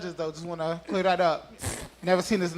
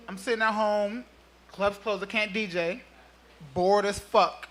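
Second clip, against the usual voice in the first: ordinary delivery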